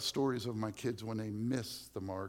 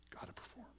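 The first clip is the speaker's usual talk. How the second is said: whispered inner nag; 12 dB quieter than usual. Retold or imitated. Preacher voicing the believer's anxious inner nag, whispered. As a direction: hush it like a pressing thought.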